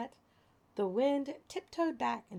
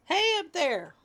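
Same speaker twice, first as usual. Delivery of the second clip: high, loud yell upward; 7 semitones higher, 7 dB louder, slower than usual. A narrator's reading. Man in manhole yelling up. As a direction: yell up out of the hole. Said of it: "yelled the man in the manhole".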